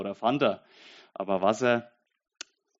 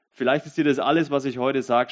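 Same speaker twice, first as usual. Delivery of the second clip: raised voice; 3 semitones higher, 6 dB louder than usual. Real, not acted. Preacher raises voice to listener.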